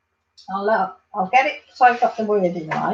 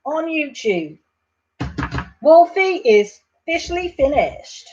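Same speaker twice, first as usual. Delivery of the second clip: ordinary delivery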